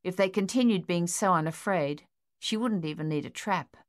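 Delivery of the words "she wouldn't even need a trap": plain reading voice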